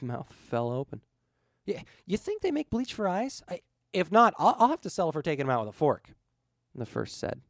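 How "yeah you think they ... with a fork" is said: high, fast, flustered complaint; appalled rant. A narrator's reading. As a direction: sputter in comic horror. Appalled at what he saw.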